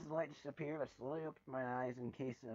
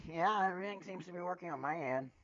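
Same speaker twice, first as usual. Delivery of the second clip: higher, louder answer; a pony answering Applejack's call, louder; calling back; 6 semitones higher, 8 dB louder than usual. Acted. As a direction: call back across the room.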